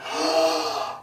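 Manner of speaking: big gasping inhale; wolf filling his lungs; deep breath in. Acted. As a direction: suck in a huge breath before blowing. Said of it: "took a deep breath and went".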